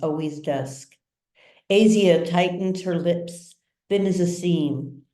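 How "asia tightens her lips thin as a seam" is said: usual speaking voice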